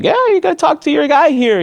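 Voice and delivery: high-pitched, animated retelling; imitated lawyer's urging. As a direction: pipe up eagerly as the lawyer. Attorney teasing the other side.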